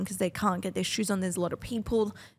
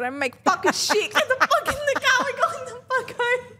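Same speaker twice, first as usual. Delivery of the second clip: high, loud yell breaking into laughter; laughing mock yell; 13 semitones higher, 4 dB louder than usual. Mum retells yelling at her son in the car, laughing. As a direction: snap at the kid while cracking up.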